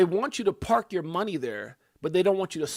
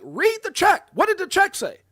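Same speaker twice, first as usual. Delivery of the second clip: much higher and louder, reliving his anger at the caller; retold angry snap; 13 semitones higher, 7 dB louder than usual. Retelling his call with the bank lady, angry retort. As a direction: fire it back at her, fed up. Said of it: "then I start getting mad".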